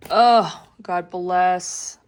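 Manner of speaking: loud heartfelt outburst; moved exclamation. Real, not acted. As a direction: burst out in gratitude. Moved by mom's gift.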